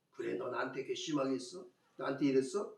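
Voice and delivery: low, soft, acted grudge; quietly nurse a mock grudge; quiet resentment. Preacher voicing someone storing up a hurt, quiet resentment. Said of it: "증오와 미움들이 생기는 거죠".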